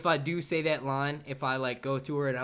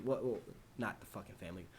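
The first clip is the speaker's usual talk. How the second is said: ordinary talk, nothing marked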